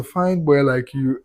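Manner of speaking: put-on slow, drawn-out "Fine boy like you"; drawn-out teasing. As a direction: stretch each word of "Fine boy like you" out slowly and teasingly. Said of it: "And I say".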